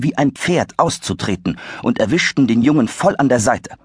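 usual speaking voice

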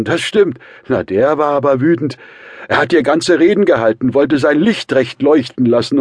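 put-on high, loud, boisterous drunk man; excited drunken yelling; tipsy shouting. A narrator's reading. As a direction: rant loudly, slightly drunk. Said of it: "schrie der angetrunkene Rasumichin".

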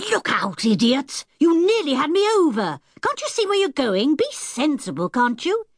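high, sharp mock-angry yell; fierce outburst. A narrator's reading. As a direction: lash out at the careless brother. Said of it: "She turned round fiercely".

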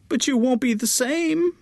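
high, plaintive mother near tears; tearful high mother. A narrator's reading. Mother to her departing daughter, high and tearful. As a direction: let the mother's voice rise and break.

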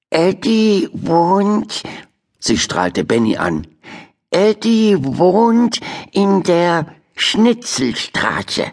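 high, slow word-by-word reading; slow halting reading. Acted. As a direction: sound out each word like a beginner. Turtle reading sign aloud.

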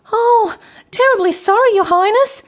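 very high, loud flustered apology; apologetic Michael. A narrator's reading. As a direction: squeak out a grovelling apology. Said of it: "apologized Michael".

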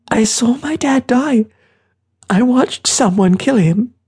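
put-on very high, anguished child voice; distraught boy. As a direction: blurt out the trauma, high-pitched. Boy confesses seeing his father killed.

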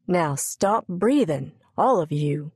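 plain reading voice

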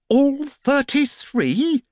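very high, slow, awed question; amazed exclamation. Acted. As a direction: marvel at the number. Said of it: "he exclaimed in amazement".

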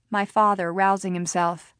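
plain reading voice

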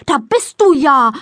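loud, high indignant exclamation; child's indignant cry on discovery; indignant call. A narrator's reading. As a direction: call out, put out at Mama. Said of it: "baut sich empört vor Mama auf und ruft".

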